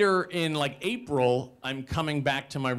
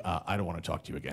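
low, soft, fast dismissal; imitated quiet brush-off; curt rejection; 9 semitones lower, 8 dB quieter, much faster than usual. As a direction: dismiss him quickly under your breath. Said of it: "she's like".

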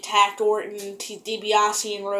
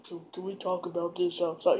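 low, hushed aside; softly muttered, trying to sound casual; playing it cool; 4 semitones lower, 7 dB quieter than usual. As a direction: keep it off the microphone. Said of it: "trying to be all cool about it".

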